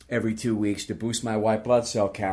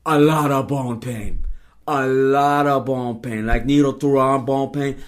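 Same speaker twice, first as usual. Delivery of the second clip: slow, loud, emphatic Filipino nurse voice; Filipino accent; 8 dB louder, slower than usual. Retold or imitated. Nurse warning as she sticks the needle in, slow Filipino accent. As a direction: drag out each warning in a heavy Filipino accent. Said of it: "that's a very good Filipino accent".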